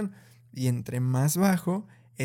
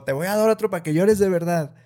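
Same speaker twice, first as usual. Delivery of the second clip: higher, louder mock threat from an angry parent; threatening parent; 5 semitones higher, 6 dB louder than usual. Parent threatening a crying child, mock angry and louder. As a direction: play the fed-up parent warning the crying child.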